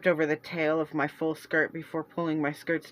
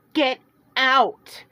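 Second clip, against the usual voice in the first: much higher, louder shout; furious shout to drive her away; 3 semitones higher, 6 dB louder than usual. Acted. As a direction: Celeste shouting her sister away from the tree. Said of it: "Celeste shouted".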